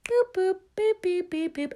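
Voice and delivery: short high boops; imitated beeps. Host mimicking a cue for the music, high boops.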